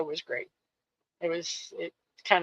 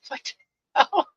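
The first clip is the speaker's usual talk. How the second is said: high, loud what the hell; startled outburst; 7 semitones higher, 5 dB louder than usual. Retold or imitated. Friend recalls hearing the odd line, high loud startled outburst. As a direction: blurt it out, caught off guard. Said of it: "I'm like".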